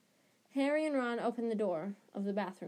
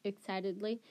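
usual speaking voice

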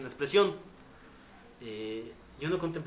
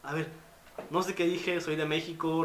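flustered apology; 4 semitones higher, 5 dB louder than usual. Retold or imitated. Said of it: "Y así".

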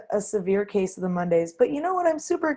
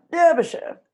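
British pronunciation; 8 semitones higher, 4 dB louder than usual. Shown as a demonstration. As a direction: say Derbyshire like a British period drama. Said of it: "I know that because I watch Pride and Prejudice".

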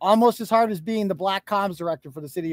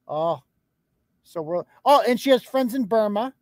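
ordinary talk

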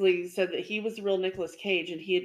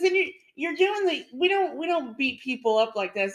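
high, loud mock Pesci lecture; hammed-up Pesci correction; Joe Pesci scolding; 7 semitones higher, 3 dB louder than usual. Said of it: "he says".

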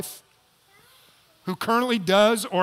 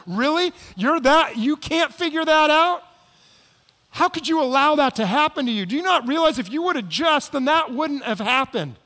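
higher, louder, scornful jabs, quickening; mock taunting; 7 semitones higher, 4 dB louder than usual. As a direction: belittle someone who messed up. Voicing a taunting critic.

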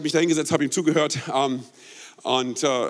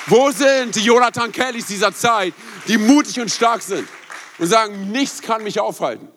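full-force preaching; 5 semitones higher, 4 dB louder than usual. Acted. Preacher firing up the church.

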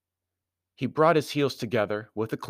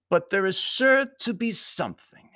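high, louder formal voice; foreign Baron; 12 semitones higher, 5 dB louder than usual. A narrator's reading. Baron explaining his suspicion to Anthony, high formal voice.

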